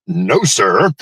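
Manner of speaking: ordinary talk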